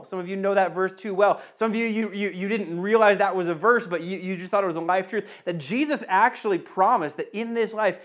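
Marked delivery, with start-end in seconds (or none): none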